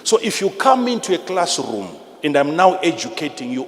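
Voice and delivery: ordinary delivery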